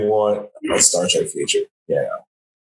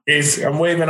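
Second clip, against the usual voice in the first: ordinary delivery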